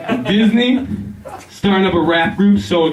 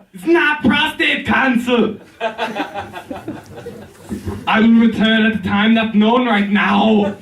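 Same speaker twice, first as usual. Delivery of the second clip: Schwarzenegger impression; 8 semitones higher, slower than usual. Arnold yelling at Dana in a botched outtake, high comic shout.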